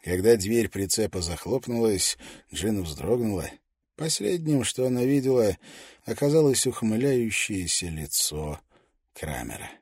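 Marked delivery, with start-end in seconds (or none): none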